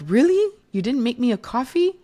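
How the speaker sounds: high, loud, outraged "really?"; mock offended at the missing coffee; indignant disbelief. Mom on Mother's Day, finding no coffee made, indignant. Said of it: "you're like".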